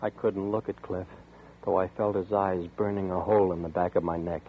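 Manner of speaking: low, hushed suspense narration; quiet, measured eerie telling; story narration. A narrator's reading. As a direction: draw the listener into the dread. Narrator's eerie first-person account.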